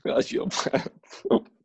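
breathless from laughing; wheezing through laughter; after laughing hard. Real, not acted. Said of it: "Deixa-me respirar".

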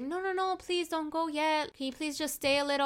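mock pleading. Date begging her not to go yet, mock pleading.

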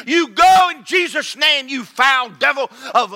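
high, loud, slow command; commanding exorcism. Retold or imitated. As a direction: drive the demon out. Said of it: "he cast that thing".

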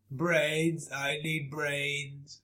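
slow, soft zombie moan. Pretend. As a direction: moan it like the undead. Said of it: "Uh, zombie".

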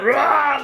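high imitated "rah"; sudden high mock roar; monster-lunge noise. Hand coming out to grab girl, monster-lunge noise.

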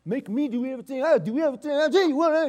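resentful muttering that runs into gibberish; put-on griping under the breath; mock grumbling. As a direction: grumble along like an unwilling helper. Mimics grumbling while obeying unwillingly.

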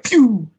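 high-pitched blaster "pew"; imitated blaster shot. Joking about a beard firing a pistol, imitated blaster shot. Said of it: "it's just like".

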